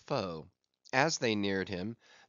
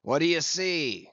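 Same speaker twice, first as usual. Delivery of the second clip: raised, louder captain's call; crying out; 4 semitones higher, 5 dB louder than usual. A narrator's reading. Ahab calling up to the lookouts, crying out. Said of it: "cried Ahab".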